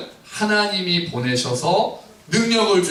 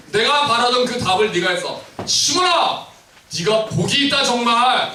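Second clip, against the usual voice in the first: high, excited outburst; Jesus thrilled by Peter's answer; 7 semitones higher than usual. Acted. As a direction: burst out with delight. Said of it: "깜짝 놀라신 거예요".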